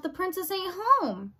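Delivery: high, wondering self-talk; talking to herself. A narrator's reading. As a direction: puzzle it out aloud. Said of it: "she said to herself".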